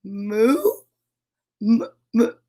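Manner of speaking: questioning moo, then stuttered starts; tentative moo. Acted. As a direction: feel out the moo hesitantly. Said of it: "finally went moo".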